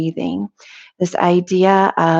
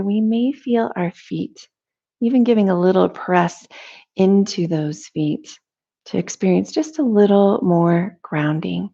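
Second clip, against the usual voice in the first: calm, measured meditation voice with long pauses; guided meditation; 2 semitones higher than usual. Real, not acted. Teacher leading listeners through a morning breathing meditation, calm guided delivery. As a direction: guide them gently into noticing breath and body, unhurried.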